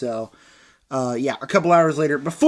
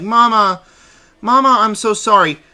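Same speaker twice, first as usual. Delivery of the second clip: lightly hammed-up apology, pitched up; mocking, higher-voiced sorry; mock pleading daughter; 4 semitones higher, 4 dB louder than usual. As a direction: imitate a daughter pleading with her mother. Mocking the repeated bedside apology.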